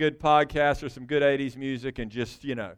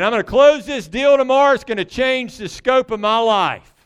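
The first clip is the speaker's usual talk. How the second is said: mock boastful; 5 semitones higher, 6 dB louder than usual. Mock boastful businessman quote.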